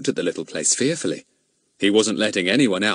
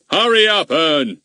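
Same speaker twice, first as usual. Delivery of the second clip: high, loud roar; 8 semitones higher, 6 dB louder, slower than usual. Acted. Goon roaring at Ern to hurry. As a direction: bellow it at the boy. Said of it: "roared Mister Goon".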